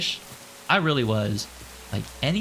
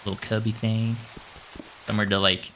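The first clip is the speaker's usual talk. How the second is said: ordinary talk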